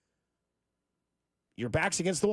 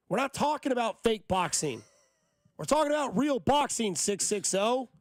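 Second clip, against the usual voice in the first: higher, heated, insistent rant; mad rant; 8 semitones higher than usual. Real, not acted. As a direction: vent real frustration at the critics. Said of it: "I'm just mad".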